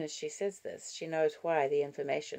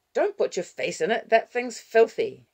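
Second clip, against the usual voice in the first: loud, raised-pitch reprimand; scolding; 8 semitones higher, 9 dB louder than usual. Acted. Grandfather grabbing the filthy cushion, scolding. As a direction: scold the child, getting louder.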